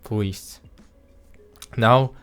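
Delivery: normal talking voice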